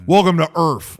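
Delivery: loud quote with "Earth" ending in f, "Earf"; misquoted "Earf". Retold or imitated. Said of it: "why are you writing it that way".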